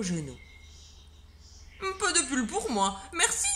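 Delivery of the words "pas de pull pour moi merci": high, loud, with a chuckle; jolly man in shorts declining; cheery refusal. Acted. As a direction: turn down the sweater cheerfully. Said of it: "dit monsieur Pommier".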